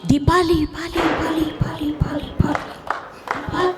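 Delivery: name echoing back and fading to a breath. Retold or imitated. Poet imitates the hill echoing her name, fading out. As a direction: let each repeat die away like an echo.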